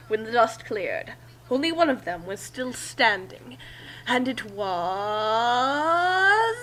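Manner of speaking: slow, stretched buildup; dragged-out suspense. Shown as a demonstration. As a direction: drag out the last words for suspense. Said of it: "drag that out a bit more".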